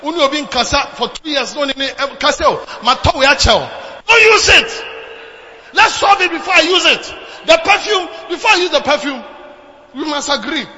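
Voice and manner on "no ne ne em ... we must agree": loud, high, shouting exhortation; fiery sermon shout; preaching at full force. Acted. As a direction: drive the point home loudly.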